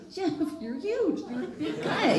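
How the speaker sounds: high laughing jab at a big friend; laughing tease. Retold or imitated. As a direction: tease him through laughter. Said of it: "And I'm going".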